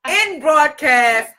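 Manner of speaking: high, drawn-out proclamation in mock outrage; mock shutdown announcement. Host shutting down the broadcast over a teasing nickname, mock outrage. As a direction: declare each ban slowly, with playful finality.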